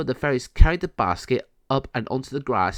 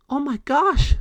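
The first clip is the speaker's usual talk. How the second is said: high, loud squeal of surprise; surprised fairy; 4 dB louder than usual. Acted. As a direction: gasp it out like a tiny fairy. Fairy Amanda surprised at kittens.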